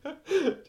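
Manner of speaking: hohes, prustendes Kichern; unterdrücktes Kichern. Real, not acted. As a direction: das Lachen kaum zurückhalten. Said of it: "Alter, hör auf zu lachen". (ersticktes Kichern)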